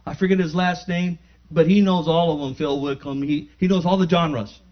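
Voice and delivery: loud, high testimony, words ringing out; fired-up sermon voice; preaching at full force. Acted. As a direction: preach it like a revival.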